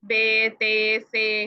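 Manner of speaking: बे, ते, से each on a long "ay" vowel; Urdu letter names. Shown as a demonstration. Teacher showing how Urdu readers say them, "ay" endings. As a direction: recite बे, ते, से as "bay, tay, say". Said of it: "Urdu में ऐसे ही पढ़ा है".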